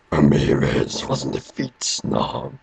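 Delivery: very low, slow, menacing villain; the Kurgan. Acted. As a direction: drag it out deep and slow. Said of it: "I can do an impression of the Kurgan".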